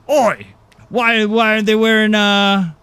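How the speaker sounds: failed Kiwi accent attempt; New Zealand accent. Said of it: "that is not at all a New Zealand accent".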